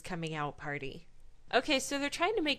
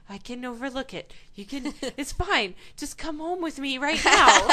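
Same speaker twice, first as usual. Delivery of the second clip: louder, higher, pleading through laughs; pleading girlfriend; 5 semitones higher, 9 dB louder than usual. Pretend. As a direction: beg her to come home. Voicing desperate Paige.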